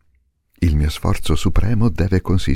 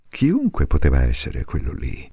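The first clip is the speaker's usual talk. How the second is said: ordinary delivery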